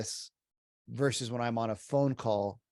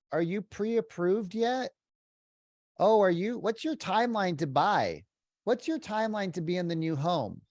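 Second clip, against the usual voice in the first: lilting pushy sales voice, pitched up; pushy sales questions; 6 semitones higher than usual. Retold or imitated. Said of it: "how many agents ask the question".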